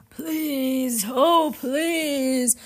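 high, loud ghostly wail; pleading ghosts. Acted. Ghosts beg to cross the river, wailing. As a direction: beg desperately, stretching each plea.

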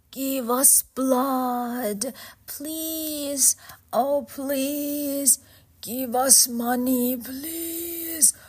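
high, drawn-out ghostly wail; pleading ghosts. Acted. Ghosts beg Orpheus for blood, wailing. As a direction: beg in long, keening notes.

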